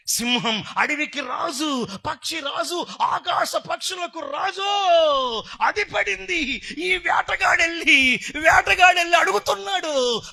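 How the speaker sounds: loud, high, drawn-out sermon shout; full-force preaching. Real, not acted. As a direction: preach it at the top of your voice. Preacher tells parable at full force. (గొంతెత్తి ఉద్వేగంగా ప్రసంగం)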